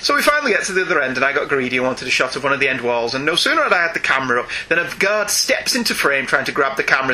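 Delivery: ordinary talk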